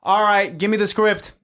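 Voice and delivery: loud, high shouted order; director calling out. Acted. Director demanding the script from crew, calling out. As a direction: call across the set to crew.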